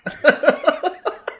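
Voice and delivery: high, loud burst of laughter; real cackle. Real, not acted. Co-host loses it at the comic panel, real loud laughing. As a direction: crack up helplessly, high and loud. Co-host cracks up mid-bit.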